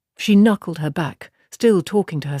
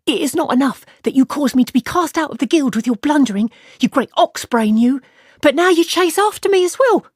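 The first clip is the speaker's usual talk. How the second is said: higher, louder, fast angry tirade; furious woman; 9 semitones higher, 4 dB louder than usual. Acted. Aludra berating Tammuz for getting her thrown out, furious and shrill. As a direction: rip into him, voice climbing.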